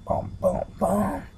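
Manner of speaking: sung-out "bum, bum, bum" villain sting; mock suspense sting. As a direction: voice a melodramatic soundtrack cue, jumping high at the end. Mock dramatic sting after villain smiles.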